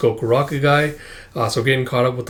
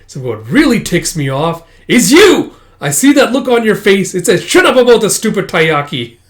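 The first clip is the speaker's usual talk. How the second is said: loud, higher annoyed outburst; mock irritated rant; 6 semitones higher, 6 dB louder than usual. Character ticked off at Taizen's look, mock rant. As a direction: let the irritation spill out, hammed-up.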